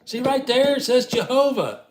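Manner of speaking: high, loud, jabbing mock insistence; insistent preacher imitation. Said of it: "He's going".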